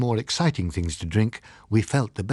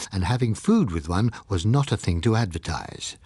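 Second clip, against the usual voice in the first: plain reading voice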